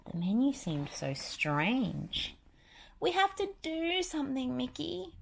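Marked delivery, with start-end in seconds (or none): 3.0-5.1 high, anxious cartoon mouse girl; worried Minnie. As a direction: urge him, high and worried. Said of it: "Minnie said".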